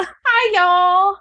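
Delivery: put-on high, childlike greeting; imitating the child. Quoting the cohost's little son, high childlike voice. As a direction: play a small boy saying hi. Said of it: "And he's like".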